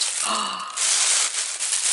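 sharp gasp of surprise; a real delighted gasp. Real, not acted. Unboxing a gift, surprised.

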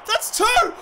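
high, thrilled goal call; excited cry. Real, not acted. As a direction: announce the goal with a high cheer.

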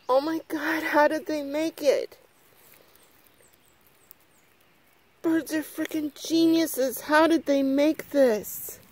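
amazed. Real, not acted. Showing viewers the bird nest, amazed. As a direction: gush in awe, drawing out the last question.

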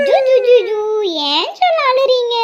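put-on high, slow cooing baby talk; baby-soothing. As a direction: coo to hush a crying baby.

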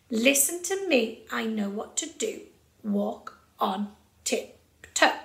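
controlled rhythm, slow spaced "walk on tiptoe"; steady beat. Shown as a demonstration. Teacher models the phrase for pupils, steady measured beat. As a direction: keep strict time, one slow beat each on "walk on tiptoe". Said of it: "keep a really steady beat".

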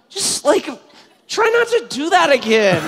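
high, halting mock-polite plea; strained politeness. Jogger to the apologetic driver who hit him, high strained politeness. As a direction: squeeze it out high, holding back anger. Acting his reply to driver.

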